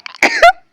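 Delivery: sudden loud sneeze. Real, not acted. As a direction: let out a big real sneeze. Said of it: "en général, il y en a trois". (gros éternuement)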